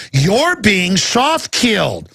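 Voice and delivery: slow, drawn-out words for emphasis; slow and insistent, as if to someone not getting it; emphatic re-explaining. Real, not acted. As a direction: spell it out slowly, stretching each word. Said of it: "let me explain it again".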